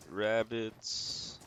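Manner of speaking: ordinary talk, nothing marked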